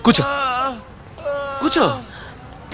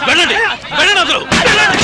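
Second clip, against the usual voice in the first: shouting at crowd; 10 semitones higher than usual. Acted. Ordering the crowd away.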